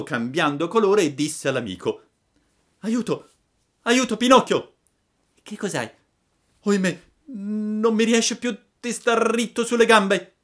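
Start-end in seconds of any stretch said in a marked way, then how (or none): 2.8-4.7 high, panicked storybook cry; acted frightened boy calling a friend; alarmed cry for help. Lucignolo staggers, cries for help. 5.4-5.9 high, anxious acted question; worried storybook boy; alarmed question. Pinocchio answers the frightened friend. 6.6-10.3 high, loud wail of dismay; hammed-up boyish distress. Boy can no longer stand.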